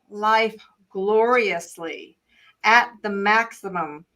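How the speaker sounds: slow, deliberate reading, each word weighed. Shown as a demonstration. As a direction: read it slowly so it sinks in. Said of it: "I'm gonna go very slow here because this is important".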